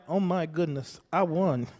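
a hushed, low "oh my goodness, I won", no excitement at all; underplay it like a winner who isn't thrilled; mocking a muted lottery winner; mock calm reaction. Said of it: "No, they're gonna be screaming".

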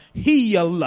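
loud Southern preacher drawing out Heal into hee-al; hammed-up Southern revival shout of Heal; Southern faith-healer accent. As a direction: imitate a Mississippi healing preacher, loud and stretched. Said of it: "That's how they say it in the South".

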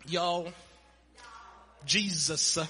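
hammed-up Mississippi accent, words stretched out; Mississippi drawl. Preacher jokes about Mississippi speech, exaggerated drawl. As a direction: stretch each word into a Southern drawl of several syllables. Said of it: "turn it into three or four syllables".

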